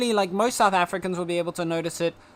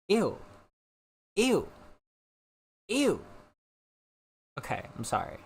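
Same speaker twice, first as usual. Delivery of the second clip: ordinary talk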